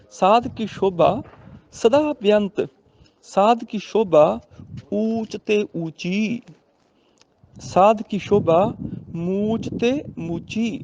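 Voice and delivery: slow, drawn-out sacred verse reading; measured teaching recitation; scripture recitation for teaching. Shown as a demonstration. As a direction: recite the line slowly, stretching the ends.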